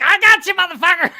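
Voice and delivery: high, loud attacking shout; mock psycho yell. Shipmate imagined bursting in at night, shrieking. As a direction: lunge at him with a crazed yell. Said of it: "open the curtains on my rack".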